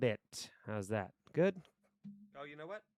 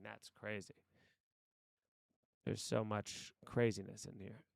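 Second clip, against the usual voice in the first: ordinary talk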